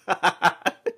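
bursting into a giddy laugh; loud happy laughter. Real, not acted. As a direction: laugh out loud from pure happiness. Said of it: "I'm just too happy".

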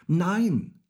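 high, loud cry of refusal; shouted refusal. A narrator's reading. Häwelmann defies the old moon, shouting. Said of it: "schrie Häwelmann".

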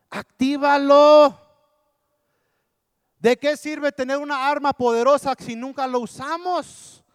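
loud, high preacher's cry; impassioned preaching. Acted. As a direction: exhort the congregation at full force. Preaches at full force.

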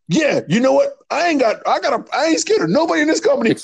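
high, fast cocky wrestler voice; tough-guy boast in a high put-on voice; high mock bravado. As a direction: puff up and rattle it off high.

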